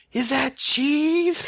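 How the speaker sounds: very high, very slow, mock-horrified squeak; imitating wife. Husband mimicking wife finding cheese, high drawn-out mock dismay. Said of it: "you said".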